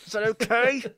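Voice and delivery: forced through clenched teeth; through gritted teeth. Pretend. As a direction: push it out past tight teeth. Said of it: "As I say through my teeth".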